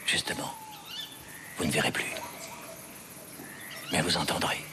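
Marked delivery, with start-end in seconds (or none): 1.6-4.6 low menace. Acted.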